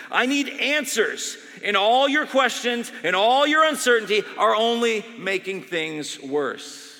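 loud, high, slow insistence; desperate demand. Retold or imitated. Dying doctor demanding answers from the chaplain. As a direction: hammer home the need.

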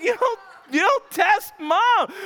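slow, high, loud laugh-cry; dad laughing at his defiant son; laughing warning. Retold or imitated. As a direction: wheeze it out through laughter.

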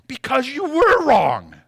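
loud, slow, every word hammered; emphatic retort. Real, not acted. Preacher answers the complainer, slow, loud, hammered retort. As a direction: drive it home, slow and loud. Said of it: "retort to the imagined complainer".

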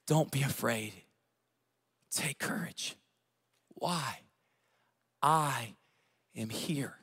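very soft words with long pauses; quiet, low divine comfort; hushed reassurance. Acted. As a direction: speak tenderly, letting each phrase settle. Preacher voices Jesus's reassurance.

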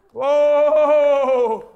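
loud drawn-out whoa; preacher's whoa. Real, not acted. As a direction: let out a long exclamation. Preacher's exclamation.

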